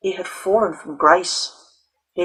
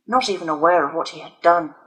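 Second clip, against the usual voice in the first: plain reading voice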